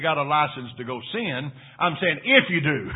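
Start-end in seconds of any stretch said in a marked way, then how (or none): 1.8-2.9 IF pressed hard, louder than the words around it; stress on IF. Shown as a demonstration. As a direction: punch the word if. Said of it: "I want to emphasize that word, if".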